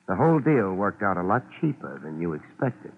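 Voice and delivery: ordinary talk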